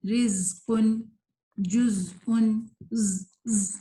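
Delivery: slow, deliberate words with long buzzing ز; correct articulation. Shown as a demonstration. Teacher modelling the sound that should come, slow buzzing ز. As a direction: stretch وزن and زبرن, letting the ز whistle. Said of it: "یہ آواز آنی چاہیے".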